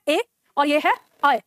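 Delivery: slow, pure ए, then अ widened into an ai glide; contrasting e and ai. Shown as a demonstration. Teacher shows the difference, ए pure and अ as ai, slowly. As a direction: set ए apart from अ, said as ai. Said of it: "दोनों में अंतर है".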